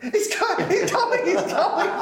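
high, loud panicked squeal, laughing. Retold or imitated. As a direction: squeal it out while fleeing in panic. Panicked fleeing, agent told.

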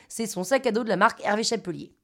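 ordinary delivery, nothing marked

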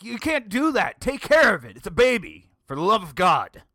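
loud, high, exasperated scolding; hammed-up indignant shouting; mock outrage. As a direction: yell it like an appalled bystander. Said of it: "I'm like".

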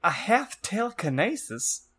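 high, wailing dark lord; theatrical high-pitched villain answer; cruel villain. Acted. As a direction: gloat in a hammed-up high voice. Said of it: "he answered cruelly".

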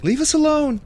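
high, loud outburst; loud, high-pitched cry to back off; character's shout. Acted. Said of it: "Ben is just gonna shout".

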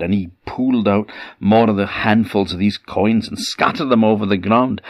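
ordinary delivery

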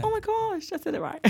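surprised. Real, not acted. Amazed she got word right.

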